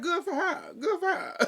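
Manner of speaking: high, prissy mock voice; mimicking Kathy. Imitating Kathy's fake congratulations, high mock voice. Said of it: "Kathy went".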